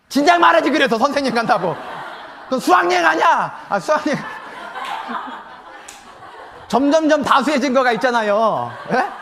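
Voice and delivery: loud, high laughing exclamation; amused loud outburst. Real, not acted. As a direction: laugh it out in disbelief. Laughing reaction to punchline.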